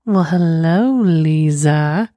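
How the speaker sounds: drawn-out teasing hello; flirty greeting. Real, not acted. Host greets letter writer flirtatiously.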